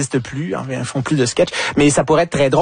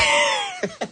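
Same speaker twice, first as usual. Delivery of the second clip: high laugh; 5 dB quieter than usual. Real, not acted. Rire après la remarque.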